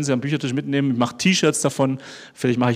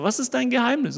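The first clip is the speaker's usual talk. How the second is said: curious question; 10 semitones higher than usual. Retold or imitated. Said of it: "dass sie sagen".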